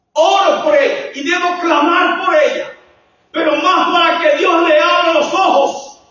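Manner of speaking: high, loud, fervent preaching. Real, not acted. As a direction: preach at full force to the congregation.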